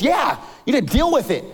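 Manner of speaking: high, rapid indignant rant; frustrated customer. Acted. Man threatens pet store manager with bad review, indignant. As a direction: rattle off threats like an outraged customer. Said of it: "he gets a little frustrated".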